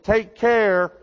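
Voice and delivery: mock a friend piling on the cares; mock farewell. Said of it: "they say".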